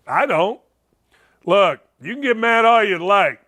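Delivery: usual speaking voice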